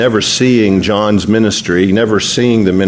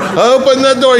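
much higher, panicked begging; frantic pleading; 12 semitones higher than usual. Retold or imitated. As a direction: shriek it in rising panic. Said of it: "and he says".

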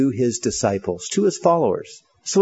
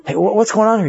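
higher, stammered confusion; alarmed bystander; 8 semitones higher, 4 dB louder, faster than usual. Acted. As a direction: blurt it out like a startled onlooker. Startled visitor at church fight.

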